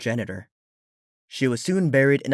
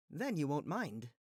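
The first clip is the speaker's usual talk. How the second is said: much softer, gentle; soft knowing voice; 4 semitones higher, 15 dB quieter than usual. Acted. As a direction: say it quietly, gently firm. Celestia softly behind door.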